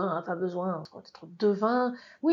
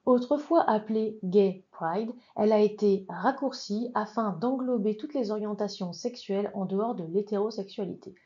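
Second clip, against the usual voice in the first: normal talking voice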